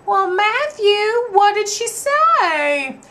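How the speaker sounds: high, slow sweet Southern lady; grandma voice. Retold or imitated. Grandmother slyly questioning the boy, high and slow. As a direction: ask it slowly and sweetly, laying a trap. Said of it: "was like".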